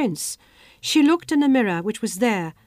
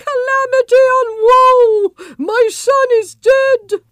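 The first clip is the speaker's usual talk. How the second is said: much higher, loud anguished wailing; shrill wail of pain; 13 semitones higher, 10 dB louder, slower than usual. Acted. Queen lamenting to the palace, shrill and loud. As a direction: wail it out as if grieving. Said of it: "cried in a voice shrill with pain".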